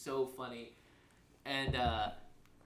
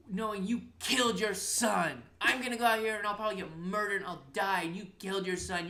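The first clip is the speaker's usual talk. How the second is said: higher, insistent imitated threat; dramatic guilt-trip; 6 semitones higher, 4 dB louder than usual. Son guilt-tripping his mom on the highway, mock-dramatic. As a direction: lay it on thick, mimicking a melodramatic son. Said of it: "Taylor's so fucking dramatic".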